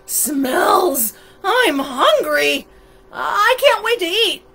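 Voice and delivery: loud, excited story-monster voice; eager hungry monster. A narrator's reading. Monster smelling food in the city, loud and eager. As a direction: exclaim it with ravenous excitement.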